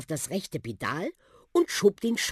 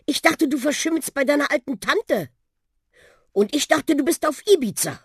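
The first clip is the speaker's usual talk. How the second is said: put-on high-pitched astonished boy; surprised exchange; 9 semitones higher, 4 dB louder than usual. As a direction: blurt it in surprise. Friends surprised to meet at door. (verblüffter Junge, hohe Stimme)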